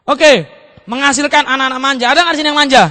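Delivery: ordinary talk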